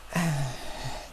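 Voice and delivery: long, soft exhaled sigh; heavy sigh. Real, not acted. Vlogger reacting to the stepdad story, heavy sigh. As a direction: sigh it out after an awkward tale.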